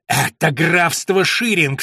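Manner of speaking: loud, slow, emphatic outcry; angry shout. A narrator's reading. Young lord outraged at an empty treasury, shouting. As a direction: thunder it out, stretching the words. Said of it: "вскричал он так громко".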